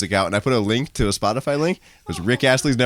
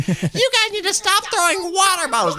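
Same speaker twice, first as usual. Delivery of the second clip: very high, drawn-out mock scolding; hammed-up high-pitched telling-off; yelling lady. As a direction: imitate an irate woman, slow and pitched way up. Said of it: "She yells at the guitarist".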